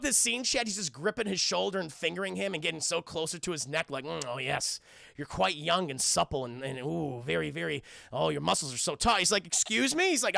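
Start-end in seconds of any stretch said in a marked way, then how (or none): 4.0-9.2 low, lecherous mock purr; creepy admirer. Impostor seer fondling the prince's shoulder, creepy low admiration. 9.5-10.1 raised, indignant questioning voice; mock taken aback. Prince reacting to creepy groping, mock taken aback.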